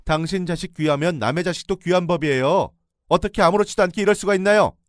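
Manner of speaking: high, loud, enraged yelling; furious shout. Retold or imitated. Narrator screams at the in-laws after the slap. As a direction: let the rage burst out. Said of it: "악에 받쳐 소리를 질렀어요".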